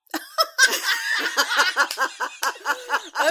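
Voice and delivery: long, high burst of laughter; real uncontrolled cackling laugh; loud belly laugh. Real, not acted. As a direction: crack up completely. Cracking up at the silly name.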